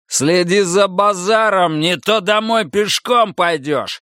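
high, drawn-out angry warning; barked threat. A narrator's reading. Buddy threatens to make Bobby walk, barking. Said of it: "рявкнул Бадди".

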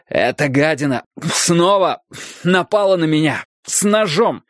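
breathless after the fight; out of breath. A narrator's reading. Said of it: "задыхаясь".

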